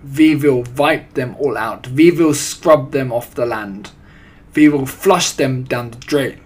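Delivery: put-on loud, accented witch with w said as v; forceful, emphatic foreign-accented orders; Grand High Witch. A narrator's reading. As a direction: rant like a tyrant villainess. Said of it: "screamed the Grand High Witch".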